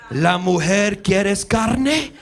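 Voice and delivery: leer like a cartoon Latin lover; mock Spanish.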